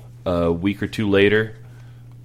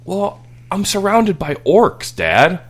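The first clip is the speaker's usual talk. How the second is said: higher, louder, slow matter-of-fact whine; indignant kid excuse; 12 semitones higher, 7 dB louder, slower than usual. Retold or imitated. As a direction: plead the obvious to dad. Son excuses himself.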